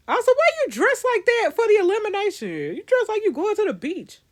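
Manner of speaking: high-pitched, incredulous ribbing; teasing disbelief. Real, not acted. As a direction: call him out on his outfit in a high voice. Teasing a character's outfit.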